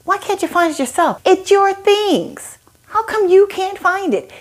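mock screaming at the husband who lost his things; mock exasperated scream. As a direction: let the pent-up frustration burst out high. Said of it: "ready to just scream and be like".

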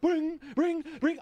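high-pitched ringing game alert; sing-song high repeated pings; imitated game chime. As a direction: mimic level-up dings popping up. Imitating game level-up sound.

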